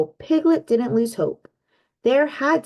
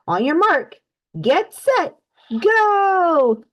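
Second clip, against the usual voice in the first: rising to a loud GO; calling out race start; 8 semitones higher, 4 dB louder, much slower than usual. Acted. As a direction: build up to a big shout. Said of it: "Kanga cried".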